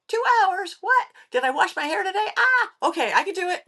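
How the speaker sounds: high-pitched, startled panic replayed; flustered disbelief. Replaying her shock at a last-minute call, flustered and high. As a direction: yelp it, thrown by the short notice. Flustered reaction to short notice.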